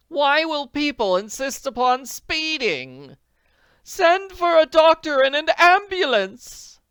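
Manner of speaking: very high, loud, slow call; urgent cry. Acted. Potter orders a doctor, urgent high cry. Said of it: "Missus Potter cried".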